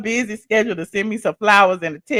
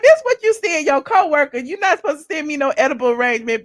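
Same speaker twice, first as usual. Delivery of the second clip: higher-pitched mock-mad scolding; retold indignation; 6 semitones higher, faster than usual. Daughter telling mama off over edible arrangement, retold indignation. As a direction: scold in a high, put-out voice. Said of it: "But I was so mad".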